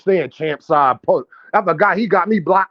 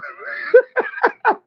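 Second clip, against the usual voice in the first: high, squealing laugh. Real, not acted. Laughing at the fighter's name just announced to viewers, high laugh. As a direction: burst into high-pitched laughter. Cackling at the named opponent.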